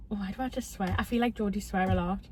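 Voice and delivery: ordinary delivery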